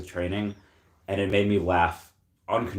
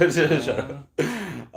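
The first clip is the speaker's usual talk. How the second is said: laughing; 7 semitones higher than usual. Real, not acted. Tipsy laugh after apology.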